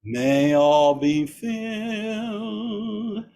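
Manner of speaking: normal talking voice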